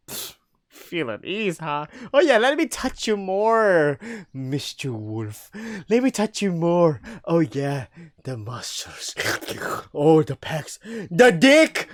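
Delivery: high, slow, hammed-up taunting voice; mocking silly voice. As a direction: ham it up, mocking the touch. Wolf mocks in a silly voice.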